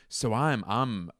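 normal talking voice

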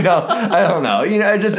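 high, laughing through words. Real, not acted. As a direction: giggle while you speak. Laughs at own joke.

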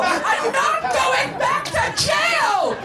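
very high, hugely drawn-out scream; escalated frantic screaming. A narrator's reading. Missus Garrett insists she won't risk jail, escalated screaming. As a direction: stretch every word into a hammed-up screech. Same refusal repeated, more drawn out.

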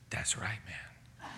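slow, low imitated tough-guy whisper; menacing whisper. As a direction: hiss it like a threat. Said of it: "He turns around and he goes".

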